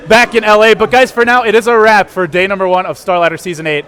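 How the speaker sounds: broadcast sign-off. Acted.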